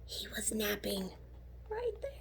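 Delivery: hushed unvoiced whisper. A narrator's reading. Narrator whispers about the sleeping kangaroo. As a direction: whisper so you won't wake him. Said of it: "sleeping kangaroo".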